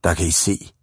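low, grunted bully voice; grunting. A narrator's reading. Ivan has caught out the small boys, grunting. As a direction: grunt it low at the little ones. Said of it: "gryntede Ivan".

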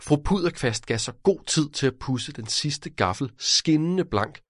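plain reading voice

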